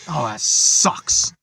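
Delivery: movie quote voice. Retold or imitated. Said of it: "a lot of quoting lines".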